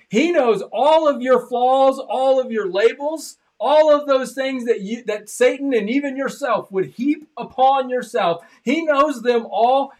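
high, loud, slow preaching climax; full-force preaching. Acted. Preacher tells listeners God knows their flaws, slow loud climax. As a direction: lift it to a slow roaring peak.

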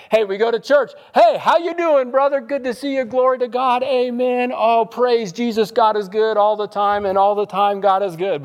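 high, bright mock-happy church greeting; fake cheerful churchgoer. Pastor mocking a fake-happy churchgoer's greeting. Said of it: "It's happy face that we put on".

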